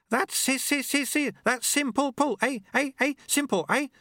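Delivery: very high voice skipping like a stuck record; chirpy voice caught in a time loop; stuttering apprentice. A narrator's reading.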